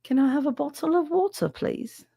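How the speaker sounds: high, put-on British accent with careful t; prissy British bottle of water; posh British accent. Shown as a demonstration. Answering chat, mock British accent for a water order. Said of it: "we don't all speak like moron".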